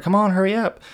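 high-pitched hurrying call; impatient urging. Retold or imitated. Friends hurrying him out of the car, impatient.

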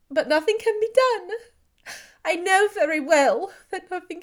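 high, loud, anguished; despairing. Acted. Elizabeth answering Darcy, high and anguished despair. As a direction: pour out hopeless anguish. Heroine in despair over sister.

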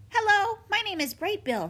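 high, loud call over the crashing waves; gosling calls out to the otters; shouting over waves. A narrator's reading. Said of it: "he shouted over the waves".

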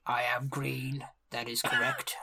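quiet, slow, deadpan orc reply; orc chieftain. Acted. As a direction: answer solemnly, low and unhurried. Orc chieftain answers literally.

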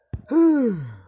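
loud, high sighing cry; loud vocal sigh; exasperated sigh. Real, not acted. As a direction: let out a big high-pitched sigh. Exasperated pause after the rant.

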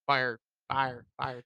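"fire" said low with its final r sounded; careful pronunciation of fire. Shown as a demonstration. Testing the mocked word, low careful "fire". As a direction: try out "fire" softly, ending on a clear r. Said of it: "when I say fire".

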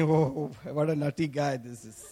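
imagined inner thought. Retold or imitated. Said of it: "You must be thinking".

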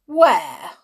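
loud one-word challenge; sharp demand. A narrator's reading. Matron presses Tom about his parents, sharp. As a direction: fire the question out loudly.

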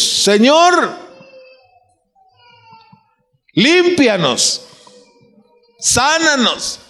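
clamor agudo con sílabas alargadas; llama desde lejos sin subir el volumen; llamado de lejos. Acted. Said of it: "Le gritaron de lejos". (grito fingido, lento y agudo)

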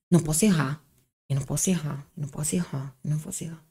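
anxious inner voice fading out; fading self-talk. Retold or imitated. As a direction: mutter it to yourself, trailing away.

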